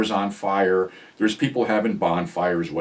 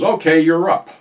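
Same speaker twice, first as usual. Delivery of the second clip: loud, higher call-out; retold yell; 8 semitones higher, 8 dB louder than usual. Friend calls narrator back to the car, yelling. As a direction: holler it to someone outside. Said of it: "and he yells".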